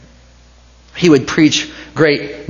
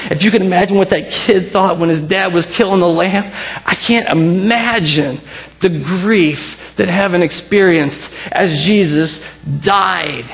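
impassioned preaching; 6 semitones higher than usual. Acted. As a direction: swell into a fervent preacher's climax. Preacher builds to full force.

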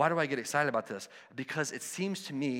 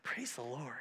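hushed, much softer praise; awed whisper; 10 dB quieter than usual. Retold or imitated. Pastor reacting to his friend's faith, hushed praise. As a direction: breathe it out in quiet awe. Hearing friend came to believe.

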